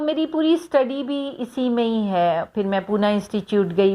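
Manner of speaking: ordinary talk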